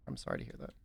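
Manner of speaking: whispered, soft and dry; whispered aside. Real, not acted. Streamer to himself after falling on his face, whispered aside. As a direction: breathe it out as a deadpan aside. Mock sympathy after failing.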